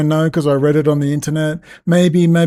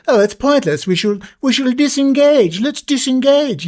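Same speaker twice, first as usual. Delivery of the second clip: high, whining mock quote; mocking defeatists; 6 semitones higher than usual. Ranter mimicking those who say to disengage, mocking. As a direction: imitate the quitters in a raised, mocking voice. Mocks people urging disengagement.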